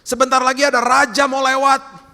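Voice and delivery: high, loud crier's call; herald's shout. Acted. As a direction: holler a royal arrival to the whole town. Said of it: "dia teriak".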